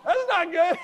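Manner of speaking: high, loud mock alarm at the pun; mock dismay. Speaker reacting to the crew yelling the pun, mock alarmed. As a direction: squeal out a comic oh-no.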